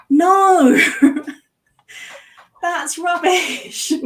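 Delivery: loud and high, laughing; laughing rant. Real, not acted. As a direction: burst out laughing mid-rant. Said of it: "I'm in full rant mode".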